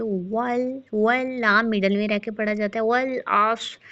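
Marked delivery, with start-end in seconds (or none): 2.8-3.8 अस्र with ain from the throat; proper ain. Shown as a demonstration. Teacher showing the ain sound, careful and slow. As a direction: say the ain of अस्र from its right place. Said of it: "आइन का मखरज दुरुस्त अदा करना है".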